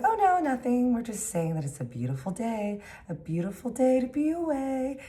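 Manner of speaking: high, sing-song fake innocence; innocent cover-up. Caught by the warden, sing-song innocent denial. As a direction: sing it sweetly to hide the plan.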